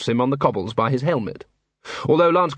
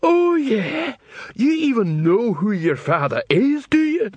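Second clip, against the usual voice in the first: high, slow troll taunt; jeering troll; 10 semitones higher, slower than usual. Acted. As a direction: jeer it high and drawn out. Heckling troll in novel.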